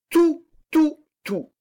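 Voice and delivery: mounting passionate offer; impassioned surrender. A narrator's reading. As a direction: give it all away, each word flung out high. Marquise offers everything, scene climax.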